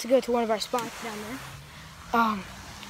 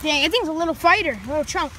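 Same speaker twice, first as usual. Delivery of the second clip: loud, higher-pitched amazement; excited exclamation; 4 semitones higher, 7 dB louder than usual. Real, not acted. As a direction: exclaim at a feisty catch. Reeling in a fighting fish.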